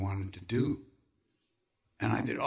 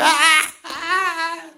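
much higher, much louder laughing cries; guru laughing hard; 12 dB louder than usual. Retold or imitated. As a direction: crack up helplessly. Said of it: "He laughed so hard".

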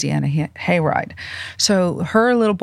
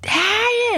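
high, drawn-out exasperated "ah"; hot-tempered wail, played for the story; mock angry yell. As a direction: imitate a hot-tempered outburst in a sustained high cry. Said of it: "Italian temper".

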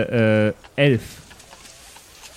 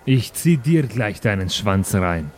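lowered retort; 6 semitones lower, slower than usual. Acted. Slotan answers the rival's taunt.

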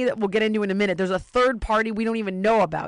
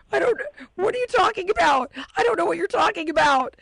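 high, loud flustered denial, voice cracking; panicked Giancarlo; 9 semitones higher, 4 dB louder than usual. Retold or imitated. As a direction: play him squeaky and caught out.

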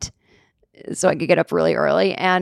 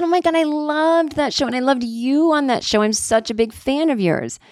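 gushing fan; 7 semitones higher than usual. Retold or imitated. Waitress meeting a comedian she loved on TV, imitated gushing. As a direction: gush like a starstruck fan, pitched well up.

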